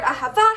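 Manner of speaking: Ahava stressed on the last syllable, a-ha-VA; stress on final syllable. Shown as a demonstration. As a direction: land on the VA in Ahava. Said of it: "has the emphasis on the final syllable".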